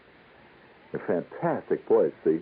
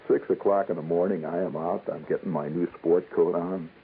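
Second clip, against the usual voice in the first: ordinary talk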